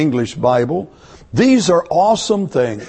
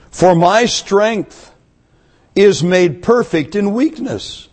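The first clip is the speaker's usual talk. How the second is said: preached scripture; 5 semitones higher, slower than usual. Acted. Scripture proclaimed, slow and raised.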